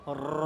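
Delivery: a rolled র like calling cattle; trilled র, low and quiet. Shown as a demonstration. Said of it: "জিহ্বার আগা উপরে বাড়ি খাওয়াইতে হবে".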